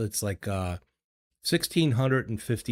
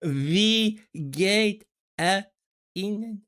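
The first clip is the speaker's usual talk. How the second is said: slow, high mock over-enunciation; 10 semitones higher, much slower than usual. Shown as a demonstration. Mocking slow over-enunciation of German to a friend. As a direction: stretch each syllable up high. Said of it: "going slower".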